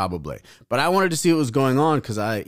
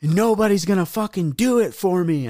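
high-pitched mock yell of a girl; imitated yelling; 9 semitones higher than usual. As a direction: imitate her worked-up, high-pitched demand. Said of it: "yelling and she's like".

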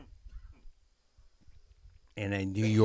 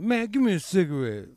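bum begging; 8 semitones higher, 3 dB louder than usual. Retold or imitated. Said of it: "acting like".

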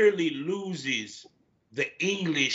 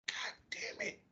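very soft, whispered curse; retold dismay; 17 dB quieter than usual. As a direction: swear under your breath in defeat. Retold dismay at Superman actor.

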